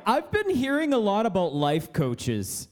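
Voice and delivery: normal talking voice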